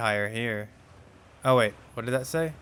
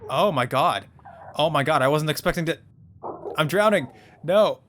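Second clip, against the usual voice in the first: startled panic; 3 semitones higher, 5 dB louder than usual. Real, not acted. Player caught drowning in a game geyser, loud panic. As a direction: cry out in sudden panic while going under.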